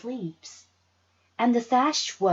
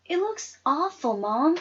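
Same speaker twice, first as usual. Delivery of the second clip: complaining; 8 semitones higher than usual. Acted. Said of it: "I complained".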